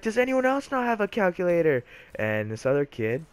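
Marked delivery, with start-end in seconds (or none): 0.0-1.8 high-pitched, challenging mock demand; imitating irate teacher. Teacher challenging the class about calculators, mock irate.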